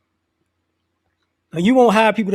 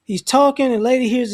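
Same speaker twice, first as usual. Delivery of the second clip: normal talking voice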